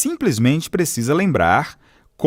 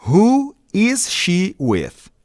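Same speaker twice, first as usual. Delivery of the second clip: slow model phrase; 6 semitones higher, much slower than usual. Shown as a demonstration. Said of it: "com quem ela está?".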